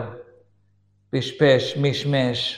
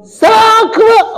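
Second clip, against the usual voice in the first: high, loud impatient yell; screamed order; 15 dB louder than usual. Retold or imitated. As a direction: screech at the driver as the wife. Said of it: "צעקות". (צעקה חסרת סבלנות)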